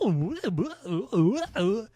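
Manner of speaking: high loud mocking ooh wails. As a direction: wail the ooh high and loud to mock him. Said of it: "weird fucked up shit that sounded like".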